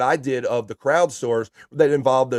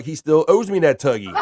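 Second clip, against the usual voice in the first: laughing; 4 semitones higher than usual. Real, not acted. Reads chat while laughing.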